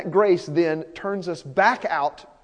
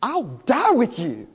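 higher, slow, emphatic vow; Peter, emphatic; 4 semitones higher, slower than usual. Retold or imitated. Disciple pledging himself to Jesus, emphatically. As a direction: stretch it into an impassioned pledge. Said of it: "But he said emphatically".

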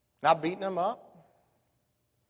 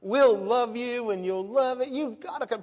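higher, insistent retold plea; begging; 8 semitones higher, 5 dB louder than usual. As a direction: imitate a friend pleading over and over. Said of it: "Christy kept begging April".

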